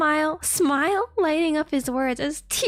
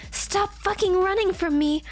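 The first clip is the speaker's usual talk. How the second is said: raised, higher shouting voice; shouted; 7 semitones higher than usual. Acted. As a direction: yell it after him. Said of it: "Izuku shouted".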